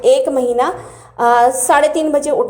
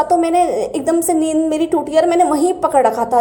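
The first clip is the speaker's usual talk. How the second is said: ordinary talk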